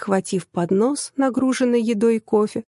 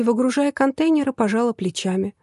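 ordinary delivery, nothing marked

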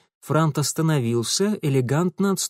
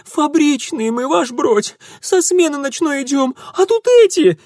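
shrill shriek, much higher and louder; shrieking plea; 13 semitones higher, 6 dB louder than usual. A narrator's reading. Girl shrieks to the bear for rescue. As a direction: shriek it out pointing at the thieves. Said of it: "заверещала она".

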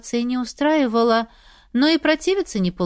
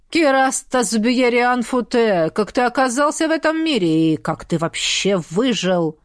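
stern voice; 2 semitones higher than usual. Acted. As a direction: sternly demand the full name. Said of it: "строгим голосом выдал".

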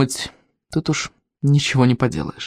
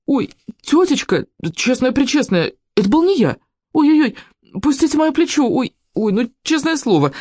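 whimpering plea; 8 semitones higher than usual. A narrator's reading. Boy pleading with saleswoman, whimpering. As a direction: whine pitifully to be let go. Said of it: "Вовка жалобно захныкал".

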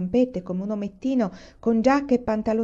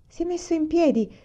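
high, delighted-sounding exclamation; excited cry of discovery; exclaiming; 10 semitones higher than usual. A narrator's reading. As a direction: exclaim it with voice pitched right up. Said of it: "esclamò Mary".

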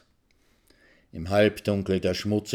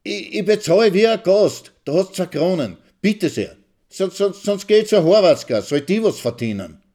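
much higher and loud, stammering; heated shouting; 10 semitones higher, 6 dB louder than usual. Acted. Husband threatening to go to another woman, shouting hotly. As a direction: spit it out in a hot rage. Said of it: "schreit Maxl hitzig".

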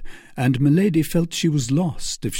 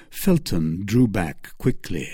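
ordinary delivery, nothing marked

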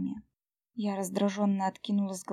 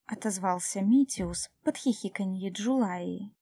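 ordinary delivery

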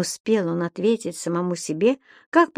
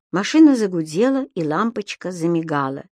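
plain reading voice

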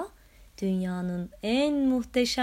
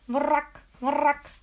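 loud, higher frog croak; croaking battle cry; 4 semitones higher, 5 dB louder than usual. A narrator's reading. Frog charges the dragon, croaking loudly. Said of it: "nidalarıyla".

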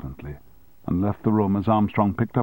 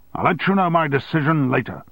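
shouted; 7 semitones higher than usual. A narrator's reading. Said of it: "he shouted".

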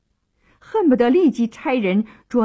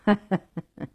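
easy storyteller's stage laugh; frank laugh. A narrator's reading. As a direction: chuckle openly before answering. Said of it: "唐城坦然笑道".

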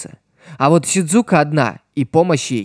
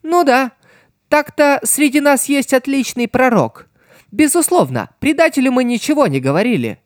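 high, bright-voiced gloating; cheerfully gloat, high pitched; 11 semitones higher than usual. A narrator's reading. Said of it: "весело ответил Пьеро".

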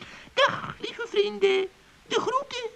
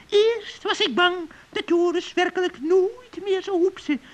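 normal talking voice

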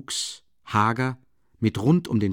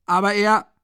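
much higher, louder cut-off protest; guard's protest; 11 semitones higher, 6 dB louder than usual. A narrator's reading. The guard begins to protest at the door, then breaks off. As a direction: start to object and break off. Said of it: "begann dieselbe Stimme".

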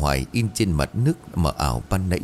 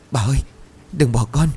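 choked-up plea; 6 semitones higher than usual. Acted. Thảo pleading over the dead grandmother, mildly choked. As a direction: beg her not to leave, voice catching. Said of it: "Thảo nghẹn ngào".

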